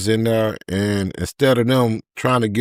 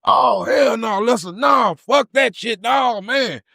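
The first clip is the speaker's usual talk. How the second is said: much higher shouted panic; screaming; 13 semitones higher, slower than usual. Retold or imitated. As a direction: yell it out in horror.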